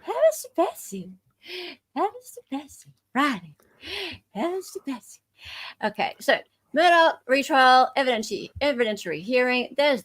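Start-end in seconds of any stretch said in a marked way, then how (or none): 0.0-3.5 put-on fussy old lady. Shown as a demonstration. Host showing co-host the voice, high fussy old lady. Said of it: "Can you do this voice". 4.3-5.2 put-on high, soft prim old-lady exclamation; fussy old lady. Shown as a demonstration. Host showing co-host the voice, high fussy old lady. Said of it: "Can you do this voice".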